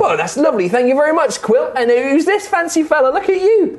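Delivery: high, chirpy praise with a laugh; admiring halfling. Acted. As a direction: cheer the brave newcomer on. GM voices the halfling Chauncey.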